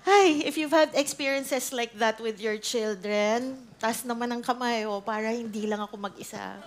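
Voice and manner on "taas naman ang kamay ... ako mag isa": usual speaking voice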